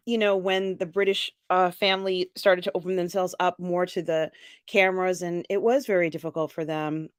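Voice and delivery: ordinary talk, nothing marked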